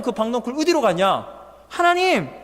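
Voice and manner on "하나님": whiny plea. Acted. Jonah complaining to God.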